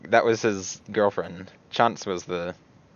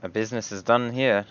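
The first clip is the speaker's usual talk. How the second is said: Hiada in character; 3 semitones higher than usual. Acted. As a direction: ask in character, unhurried and higher. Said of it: "and just say".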